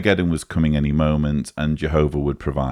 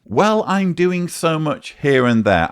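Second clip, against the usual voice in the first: higher mocking repeat; clearly articulated mocking repeat; mocking impression; 9 semitones higher than usual. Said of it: "he's slurring so badly".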